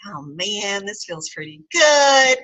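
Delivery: rising up high, slow and drawn out in delight; pleasantly surprised. Retold or imitated. To herself, three days into the trial, delighted and surprised. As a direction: let the good feeling dawn on you slowly. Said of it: "I was like".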